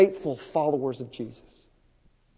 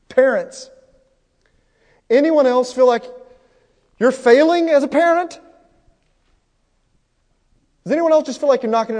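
loud, high call to the room; loud raised call; 7 semitones higher, 7 dB louder than usual. Real, not acted. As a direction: call out to the parents, louder and higher. Preacher calling out to parents.

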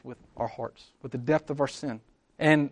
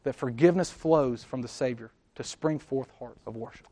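ordinary talk

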